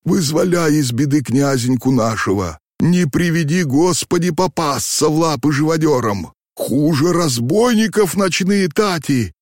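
high, anxious imploring; desperate pleading. A narrator's reading. Old man begs coachman to save the young prince, desperate. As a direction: implore him as if danger is closing in.

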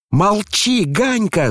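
very high-pitched command to stop; alarmed shout. A narrator's reading. Boy silencing his older brother, alarmed shout. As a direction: cry out high to silence him. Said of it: "закричал Коля".

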